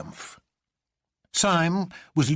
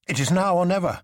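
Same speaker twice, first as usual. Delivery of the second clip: urgent, raised call; it's now or never, push it; 7 semitones higher, 4 dB louder, faster than usual. A narrator's reading. Doctor Bull urging the others to flee, urgent.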